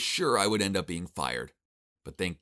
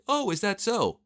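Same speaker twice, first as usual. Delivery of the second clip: high-pitched, overdone show of interest; put-on eager listening, pitched well up; feigned interest; 11 semitones higher, slower than usual. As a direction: pretend to be fascinated. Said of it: "I feigned interest by saying".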